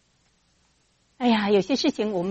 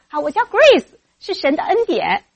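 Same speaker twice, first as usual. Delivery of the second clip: high, ringing self-introduction; loud confident answer; 7 semitones higher, 13 dB louder than usual. Shown as a demonstration. Auntie models answer for shy girl, loud confident. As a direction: announce it proudly, loud and pitched up. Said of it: "你大声告诉他".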